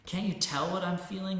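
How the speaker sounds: shouted. Acted. Angry man answering the saint, loud high shout. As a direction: yell it back, fuming. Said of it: "the man shouted".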